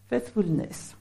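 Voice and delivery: Faithfulness said the French way, /fɛtvulnɛs/; English word in a proud French accent. Shown as a demonstration. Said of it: "C'est un bel accent, n'est-ce pas?".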